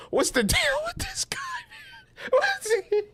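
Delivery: laughing disbelief; squealing laugh. Retold or imitated. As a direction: crack up mid-question. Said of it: "I'm just like".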